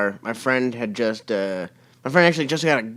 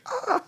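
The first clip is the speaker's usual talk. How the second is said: panicked mock scream; 6 dB quieter than usual. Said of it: "We're like".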